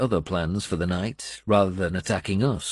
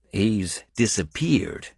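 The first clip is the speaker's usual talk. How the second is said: grim; 2 semitones higher, slower than usual. A narrator's reading. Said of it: "Finnan corrected me grimly".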